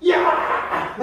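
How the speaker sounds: sudden loud demonstration yell; loud mock wake-up holler; shouting in the ear. Shown as a demonstration. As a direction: bellow it to jolt a sleeper awake. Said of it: "귀에다 대고서 고함을 질러요".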